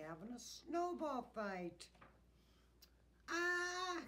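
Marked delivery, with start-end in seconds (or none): 3.3-4.0 high, loud startled "ah"; startled yell, pitched way up; sudden storybook scream; loud cry. A narrator's reading. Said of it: "cried Santa loudly".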